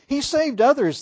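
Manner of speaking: loud, high jeering taunt; mocking. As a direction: jeer it at him from the crowd. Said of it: "mocked him".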